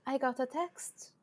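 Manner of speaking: slow, hushed mock menace; threatening voice. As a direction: imitate mom's veiled threat, drawn out and soft. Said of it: "Why are you using this threatening voice?".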